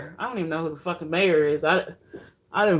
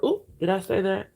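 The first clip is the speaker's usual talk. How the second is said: usual speaking voice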